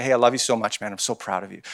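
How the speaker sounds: low, soft, heartfelt; tender sincerity. Retold or imitated. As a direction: say it quietly, straight into his soul. Uncle quietly encouraging older nephew.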